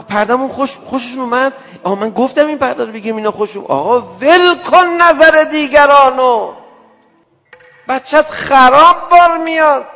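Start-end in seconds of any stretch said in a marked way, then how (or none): 3.7-9.8 loud, slow and higher fiery preaching. Acted. The preacher warns parents, loud slow fiery sermon. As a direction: thunder it at the congregation, slowly.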